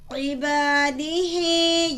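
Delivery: عبادی ہی with ہی held long and slow; stretched ending. Shown as a demonstration. Teacher shows the child how to stretch ہی. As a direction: draw out ہی in عبادی ہی for a full count. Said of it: "کھڑی زیر کو کھینچیں گے".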